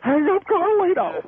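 mimicking the other caller. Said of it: "He's like".

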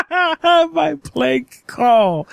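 slow, high, giggling through the words; laughing mockery. Prank caller laughing as he mocks the restaurant clerk's words. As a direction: echo her phrase while cracking up.

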